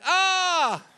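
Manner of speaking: high, drawn-out "ah" echo; high mock holler; echoed shout. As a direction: mimic the cry coming back from the valley. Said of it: "a voice shouted back".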